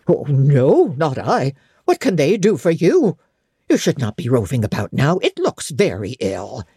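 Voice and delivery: the servant turning a vagrant away, stern; stern old servant. Acted. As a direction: brush off the beggar firmly. Hannah refuses the stranger.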